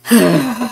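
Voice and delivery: long breathy sigh. Real, not acted. Hungry player walking all the way back, weary sigh.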